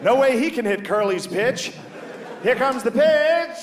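put-on high old-timey radio call, building; old radio announcer. Comic imitating an old radio announcer calling the home run, pitched high. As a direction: call the pitch like a vintage ballgame broadcaster. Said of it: "You can hear the announcers like".

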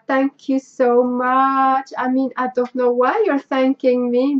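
ordinary talk, nothing marked

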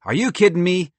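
high, loud squirrel enthusiasm; excited exclamation. Acted. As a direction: exclaim like an eager inventor. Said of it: "Merle exclaimed".

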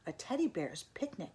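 plain reading voice

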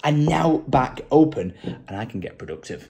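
ordinary talk

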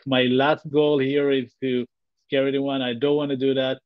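usual speaking voice

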